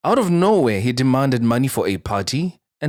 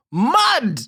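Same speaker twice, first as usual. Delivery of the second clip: sudden high squeal of shock; mud!; 11 semitones higher than usual. Real, not acted. Reacting to the ex's threat, high squeal of shock. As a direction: squeal it in disbelief.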